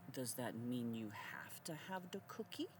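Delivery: hushed, breathy aside; a mocking whispered aside. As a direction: whisper it like a secret jab.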